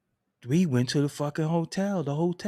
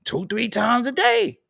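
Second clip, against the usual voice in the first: mock outrage; 4 semitones higher, 6 dB louder than usual. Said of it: "I'm like".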